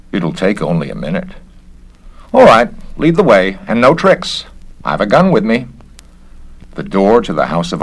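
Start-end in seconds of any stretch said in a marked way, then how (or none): 2.3-5.7 higher, louder tense official voice; a nervous man bluffing with a gun; wary inspector. Acted. Inspector warns his host at the door, tense and raised. Garrett threatens Stendhal at door.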